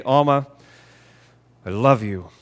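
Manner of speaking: affectionate words in an unconvincing tone; unloving tone. Shown as a demonstration. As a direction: say it without the softness, matter-of-fact. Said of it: "very different meaning just because of the way".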